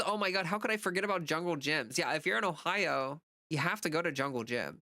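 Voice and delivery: ordinary talk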